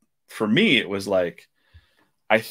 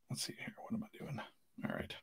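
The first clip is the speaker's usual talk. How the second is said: breathy whisper to himself, off mic; whispered aside; 21 dB quieter, faster than usual. Real, not acted. Muttering to himself while drawing.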